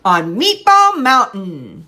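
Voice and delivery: slow, loud, stretched, stress on Meatball; slow modeled phrase. Shown as a demonstration. Therapist modeling the phrase for the child to echo. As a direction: model "On Meatball Mountain" slowly with a rise on Meatball. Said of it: "I'm varying my prosody".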